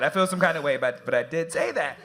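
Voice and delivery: ordinary talk, nothing marked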